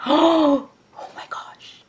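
gasp, then breathless whisper; stunned, barely voicing it; gasped surprise. Real, not acted. Vlogger stunned by the pink blend, whispered awe.

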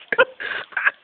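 breathy burst of laughter; cracking up despite trying not to; helpless laugh. Real, not acted. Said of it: "Don't laugh".

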